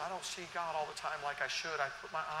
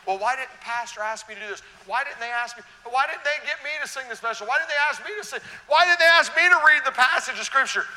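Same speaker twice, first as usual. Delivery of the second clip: mock whining complaint; 7 semitones higher, 15 dB louder than usual. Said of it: "we get sideways and bent out of shape".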